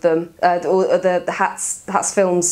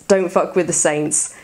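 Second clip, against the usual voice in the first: normal talking voice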